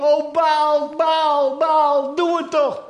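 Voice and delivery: loud, high cry to Baal; frantic shouting. Acted. Dancing priests screaming to Baal, loud high wail. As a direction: wail out the name in desperation. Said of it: "Ze schreeuwen".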